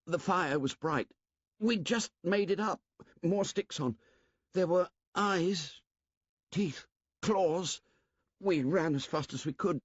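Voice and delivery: put-on high, breathless boy's voice, rising in fear; a scared boy reliving the chase; terrified boy. Twin describes the beast to the assembly, terrified.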